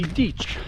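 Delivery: deech said with a long ee and a ch ending; old pronunciation deech. Shown as a demonstration. Guide contrasting Anglo-Saxon sounds for walkers, saying deech. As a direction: say deech, rhyming with leech. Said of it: "some people pronounce it as".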